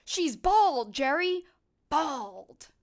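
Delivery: high, loud aghast cry; hammed-up outrage; mock shocked shout. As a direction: blurt it out in horror. Acting out a quoted complaint.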